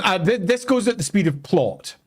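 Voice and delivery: ordinary talk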